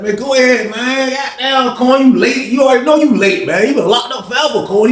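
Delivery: high mocking ribbing of an old friend; playful high-pitched teasing. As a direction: clown him for being out of touch. Teasing old head Popcorn for being late.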